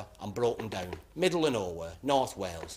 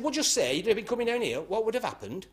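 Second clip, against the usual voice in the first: put-on high, lilting Welsh accent; 10 semitones higher than usual. Policeman questioning a driver in a Welsh accent. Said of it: "In a Welsh sort of accent".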